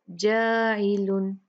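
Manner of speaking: जाइलुन drawn out slowly with a thin alif, not जॉई; slow thin recitation. Shown as a demonstration. Teacher corrects students on thin alif. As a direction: stretch it slow and light. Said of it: "कैसे पढ़ेंगे?".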